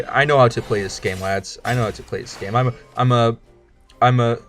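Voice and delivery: normal talking voice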